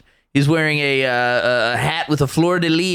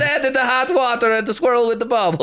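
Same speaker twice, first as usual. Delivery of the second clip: scolding parent; 9 semitones higher than usual. Acted. Scolding a child in a bathtub.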